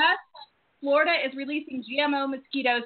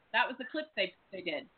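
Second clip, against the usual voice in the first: usual speaking voice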